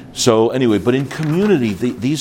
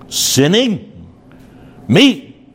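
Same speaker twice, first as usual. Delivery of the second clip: higher, affronted questioning; incredulous; 9 semitones higher, 5 dB louder, much slower than usual. Acted. Accused husband balking at the charge of sinning, incredulous. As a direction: act taken aback at the accusation. Acted reply of accused person.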